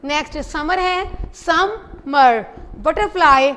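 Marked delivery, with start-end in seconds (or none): 1.4-2.5 समर split into two slow syllables. Shown as a demonstration. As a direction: pull समर apart, syllable by syllable. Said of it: "इसको separate करें".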